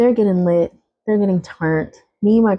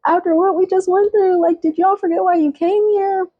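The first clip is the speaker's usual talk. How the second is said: much higher, fed-up scolding; exasperated yell; 12 semitones higher than usual. Retold or imitated. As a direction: scold them, pitch way up. Retold yelling at sisters.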